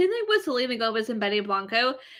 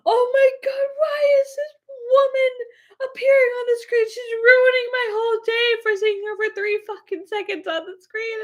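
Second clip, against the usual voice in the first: mock angry dad; 13 semitones higher than usual. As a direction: shriek like a dad outraged over the broadcast. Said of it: "getting so mad with [name]".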